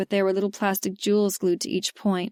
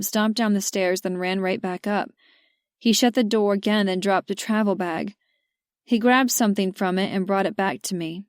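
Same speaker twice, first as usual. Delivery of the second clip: ordinary delivery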